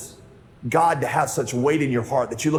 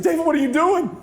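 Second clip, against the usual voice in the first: exasperated yell; 14 semitones higher than usual. Retold or imitated. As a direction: yell at the kid in disbelief. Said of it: "I was angry".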